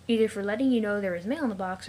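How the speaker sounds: normal talking voice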